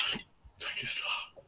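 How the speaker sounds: soft unvoiced self-calming; whispered to self. Real, not acted. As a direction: whisper a reminder to slow down. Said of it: "I'm stuttering".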